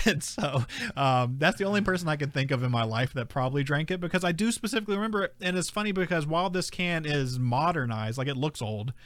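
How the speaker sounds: ordinary delivery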